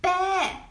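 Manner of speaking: high, bleating bä; Swedish sheep bleat. Shown as a demonstration. Showing viewers the Swedish sheep sound, high bleat. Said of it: "they call it".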